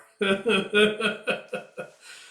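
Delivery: high, loud laugh; bright laugh. Acted. Women laughing together at the avowed sisters joke, high laugh. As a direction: burst out in a high peal of laughter.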